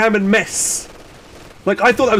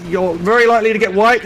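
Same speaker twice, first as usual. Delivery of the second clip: usual speaking voice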